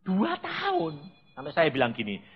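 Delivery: usual speaking voice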